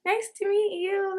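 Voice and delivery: high, drawn-out hi; slow, high-pitched awkward politeness; polite first greeting. Retold or imitated. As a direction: greet him in a put-on sweet voice. Acts out awkward first meeting.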